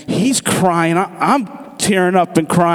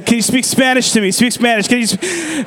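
higher, quick, tumbling requests; imitating eager pleading; 7 semitones higher than usual. Man hunting for a Spanish speaker, mock-frantic pleading. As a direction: badger them excitedly, pitch climbing to a cut-off.

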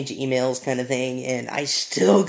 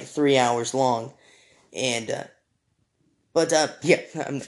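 usual speaking voice

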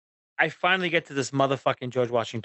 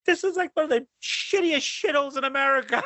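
higher, angry retold yell; yelling at the kids; 10 semitones higher than usual. Dad losing it at his arguing kids in the car, angry yell. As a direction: snap at the squabbling kids in the back. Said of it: "so I'm just going off".

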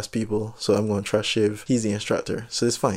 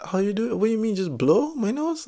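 high-pitched incredulous questioning; baffled; 10 semitones higher than usual. Retold or imitated. Student questioning the diving instructor about blowing the nose, baffled. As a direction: retell a baffled reaction, voice lifted.

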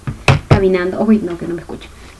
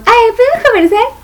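mock high, put-on voice; voz fingida; 10 semitones higher than usual. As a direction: imitate her asking in a squeaky little voice. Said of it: "todo así como fije su voz". (vocecita aguda fingida, imitándola)

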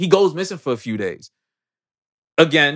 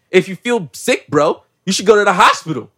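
hammed-up tough-guy threat, rising higher and louder; mock threat; 5 semitones higher, 7 dB louder than usual. [name] turns on a sick friend over the movie, mock menacing. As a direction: build the imitation threat into a raised voice.

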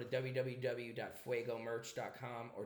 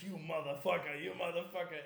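mock angry curse; 6 semitones higher, 6 dB louder than usual. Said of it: "Now he's like".

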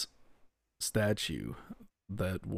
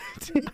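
high laugh; 5 dB louder than usual. Real, not acted. Laughs mid-denial.

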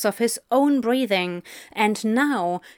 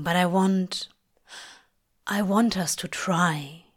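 earnest low voice, halting and unhurried; low, gentle Crowley; 3 semitones lower than usual. Acted. Crowley asking Aziraphale to try with him, low and gentle. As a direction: reason kindly, hesitating before the plea.

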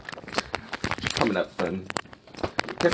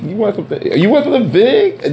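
high, louder mocking question; excited tease; 11 semitones higher, 6 dB louder than usual. Real, not acted. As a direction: egg him on with big. Mock question to listener.

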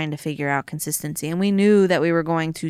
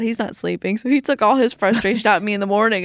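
ordinary talk

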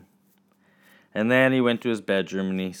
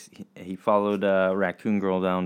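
ordinary delivery, nothing marked

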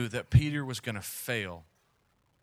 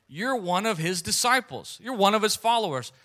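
raised pitch, pointed mock accusation; retold accusation; 7 semitones higher, 4 dB louder than usual. People confronting Peter in the courtyard, accusing.

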